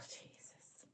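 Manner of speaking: breathless whisper of Jesus. Real, not acted. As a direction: whisper it under your breath. Reacting to dry lips.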